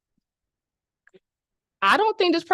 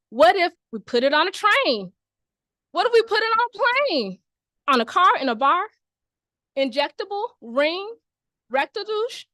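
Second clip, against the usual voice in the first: eager Seuss pitch; 7 semitones higher, 4 dB louder than usual. Acted. Sam pitching prep in Seuss rhymes.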